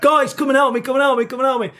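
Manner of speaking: calling down to the security lads, whining plea; whining call for help. Retold or imitated. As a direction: beg the lads to come, mock-desperate. Sick of me whining.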